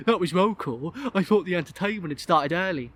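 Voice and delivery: lightly put-on young lad; boy's voice. Boy to guest who fell over, schoolboy voice. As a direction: speak as a cheeky schoolboy.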